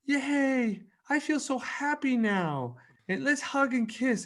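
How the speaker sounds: very high, slow, sing-out child imitation; delighted little daughter. Daughter forgives dad and wants a hug, happy high child voice. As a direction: mimic a small kid cheering sweetly, drawing the words out. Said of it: "And she goes".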